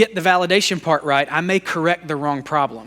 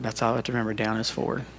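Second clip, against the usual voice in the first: muttered to himself; 6 semitones lower, 8 dB quieter, faster than usual. Real, not acted. Reminding himself of slide direction.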